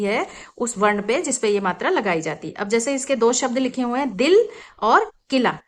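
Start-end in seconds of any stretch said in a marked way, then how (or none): none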